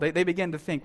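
ordinary talk, nothing marked

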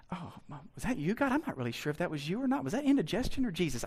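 soft, fast evasive mumble; mock unsure mumble. Shown as a demonstration. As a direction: brush it off quietly and fast. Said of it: "Here's how you do it".

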